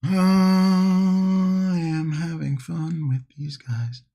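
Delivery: long, high, loud held "I", easing down; playful drawn-out. Real, not acted. Streamer to viewers about the miniatures, playful drawn-out delivery. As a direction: stretch the first word out slowly and high. Hobbyist playfully drawing out words.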